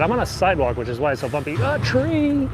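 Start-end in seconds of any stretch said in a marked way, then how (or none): 1.6-2.5 sudden high yelp at a tree; startled cry, nearly hitting a tree. Real, not acted.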